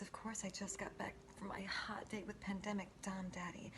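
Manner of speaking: ordinary talk, nothing marked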